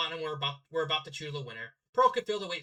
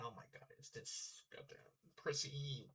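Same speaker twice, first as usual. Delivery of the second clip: whispered, slow under-breath remark; whispered aside; 5 semitones lower, 16 dB quieter than usual. Real, not acted. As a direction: whisper it to yourself, scoffing.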